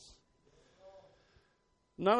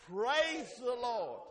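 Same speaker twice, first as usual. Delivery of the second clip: higher, slow call of praise; drawn-out praise; 7 semitones higher, much slower than usual. Shown as a demonstration. Preacher leading the congregation's practice, drawn out. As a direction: stretch it out for everyone to join. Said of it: "Let's say it again".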